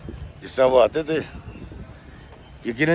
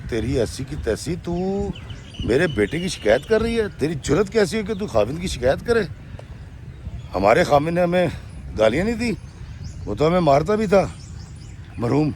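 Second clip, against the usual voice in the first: rude scolding; 5 semitones higher than usual. Retold or imitated. Mother-in-law rudely scolds the young wife for complaining. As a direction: berate the daughter-in-law with contempt. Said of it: "بڑی بدتمیزی سے اس نے کہا".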